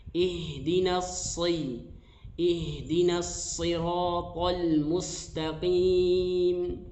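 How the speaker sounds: ihdinas siratal mustaqim slow with a clear shad; slow recitation. Shown as a demonstration. Teacher modelling the verse for students, slow. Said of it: "huruf shad makhrajnya".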